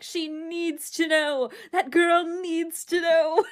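high, loud and slow, imitating Zara's insistence; insistent gossip. Zara insisting to Tanya that Liv must know, slow and loud. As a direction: draw it out like it's a moral duty. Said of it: "And she's like".

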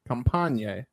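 ordinary talk, nothing marked

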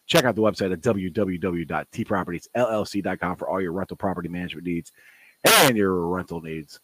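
upbeat sponsor read. Acted. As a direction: read it like a radio spot. Reads sponsor ad.

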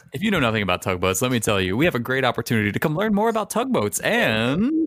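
lightly hammed-up convention pitch; playful announcer sell; mock event promo. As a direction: pitch it like a plug, rising into laughter. Mock promo for invented tugboat convention.

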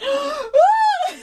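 high, loud mock shriek; playful imitation of a startled child's scream; high comic "ah!"; imitated scream. Imitating the boy's frightened cry.

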